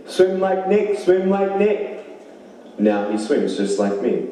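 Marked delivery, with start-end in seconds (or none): 0.1-2.0 put-on high small-child voice, the repeat slowed; high toddler voice copying what Daniel said; little boy's voice. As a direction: imitate a toddler chanting. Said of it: "he started speaking, saying".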